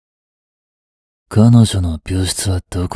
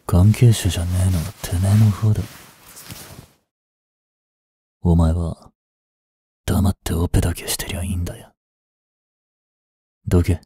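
low, quick, softer threat; menacing threat; 4 semitones lower, 4 dB quieter, faster than usual. Acted. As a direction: hiss it fast and cold. Quiet threat to the doctor.

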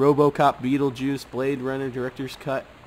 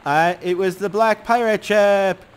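louder storybook narration; louder, dramatic reading; dramatic story reading; 5 dB louder than usual. Acted. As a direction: read it like a kid's adventure tale. Reads child's story dramatically.

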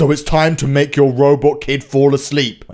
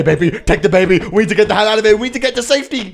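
ordinary talk, nothing marked

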